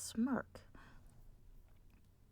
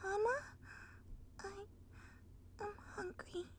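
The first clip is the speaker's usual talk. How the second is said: high, very soft whine; small filly whimper; 9 semitones higher, 11 dB quieter than usual. Acted. Fluttershy telling Twilight she is hungry, high soft whine. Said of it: "says Fluttershy".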